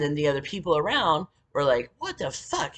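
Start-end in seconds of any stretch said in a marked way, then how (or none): 2.0-2.8 high-pitched retold astonishment; imitated high, baffled reaction; shocked disbelief.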